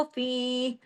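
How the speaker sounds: फी held for two beats; two-count stretch. Shown as a demonstration. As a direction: lengthen the ee of फी. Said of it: "एक को दो हरकत खींचना है". (फी दो हरकत खींचकर)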